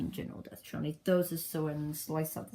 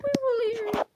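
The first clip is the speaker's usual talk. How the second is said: very high, louder character voice; squeaky declaration; 6 dB louder, faster than usual. Acted. Stranded pilot to crew, squeaky announcement.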